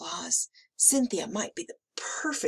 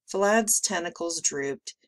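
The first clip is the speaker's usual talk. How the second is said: usual speaking voice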